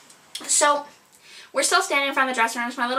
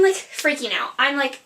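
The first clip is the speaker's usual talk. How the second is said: ordinary talk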